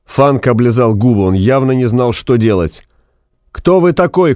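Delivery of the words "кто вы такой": high-pitched demanding cry; shouted question. A narrator's reading. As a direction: demand an answer over noise. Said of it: "крикнул Максим". (требовательный выкрик)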